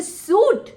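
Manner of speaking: SUIT as "soot" for suite; wrong pronunciation. Shown as a demonstration. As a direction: echo the mistaken suit with long oo. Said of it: "Listen, that's wrong".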